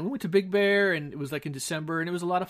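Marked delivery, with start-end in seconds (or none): 0.0-0.9 higher and louder, lit up about Big Bear; excited. Real, not acted. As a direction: lift it up with excitement. Restarting the trip story, livelier.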